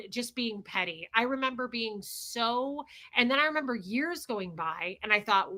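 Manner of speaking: ordinary delivery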